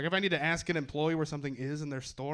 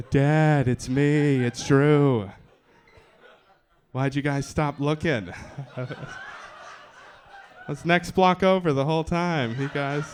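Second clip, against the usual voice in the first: put-on kid returning home; 7 dB louder, slower than usual.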